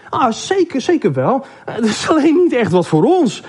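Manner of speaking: high-pitched, polite brush-off; placating. Acted. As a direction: concede quickly, then excuse yourself. Hand politely brushes off Neus's objection.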